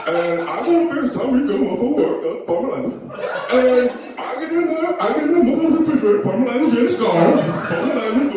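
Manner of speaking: put-on high, loud drunken rambling; drunk complainer. Drunk man telling the room about the fridge, high and loud. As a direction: rant loudly, tossing in hurried apologies. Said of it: "That's what he sounds like".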